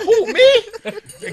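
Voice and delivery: high, surprised squeak; playful who-me; mock surprise. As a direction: act startled and innocent. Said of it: "I'm like".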